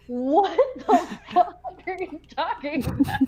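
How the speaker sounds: high, laughing through the words; laughing disbelief. Retold or imitated. Recruit laughing at officer's question, high and giggly. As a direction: giggle out the protest. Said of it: "And I'm like".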